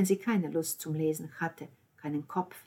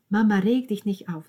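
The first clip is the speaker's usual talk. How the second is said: plain reading voice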